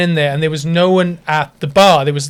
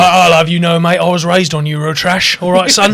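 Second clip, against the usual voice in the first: mock indignant challenge, building louder; mock confrontational; 2 semitones higher, 5 dB louder than usual. Teasing a mate with his imagined comeback, mock confrontational. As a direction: square up like you're picking a pretend fight.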